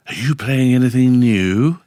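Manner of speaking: loud, slow, stretched-out put-on question; Kenny Everett. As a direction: ask it in a big comic radio voice. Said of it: "he would say, he goes".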